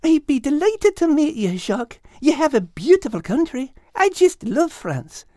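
a light motherly cat clucking over a mess; Kate, gentle. Acted. Kate asking the others to wake Al gently. Kate's voice.

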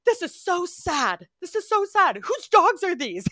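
high, loud mock distress; imitating upset son. Mother imitating son's heartbreak over stray dogs, high and loud. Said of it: "in total, like, emotional upset over these dogs".

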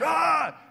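high short shout like a charging goalie; goalie yell. Retold or imitated. Preacher imitating the charging goalie, sudden high shout. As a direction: bark it like an intimidating keeper. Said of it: "And he's like".

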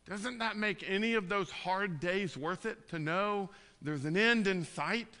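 rising sermon climax, pleading and loud; impassioned preaching. Acted. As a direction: preach it out to the congregation with fire.

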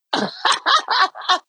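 short high-pitched laugh. Real, not acted. Laugh after joke.

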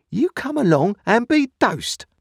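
high, loud, commanding character voice; stern sister. A narrator's reading. Sister hauling Pip off for tar water, sternly. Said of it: "nothing more than the awful words".

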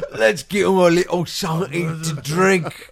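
slow, loud Cockney parody; exaggerated Cockney movie-hero voice; full Cockney. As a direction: ham it up in broad Cockney, half laughing. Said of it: "he went full Cockney on that".

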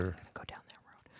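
hushed whisper; playful conspiratorial whisper. Real, not acted. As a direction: drop to a whisper. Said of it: "Why are we whispering?".

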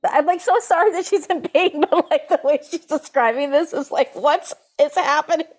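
high, disbelieving laughy rise; half laughing at the absurdity; incredulous. Real, not acted. Reacting to the glue-finger story, high disbelief.

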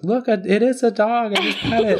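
high, eager child impression; little kid. Second kid pointing out the dog, high child voice. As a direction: imitate an excited little kid.

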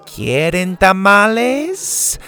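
slow, high, sweet Spanish offer; Mexican accent. A narrator's reading. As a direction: offer food warmly in Spanish. Dora offers tamales.

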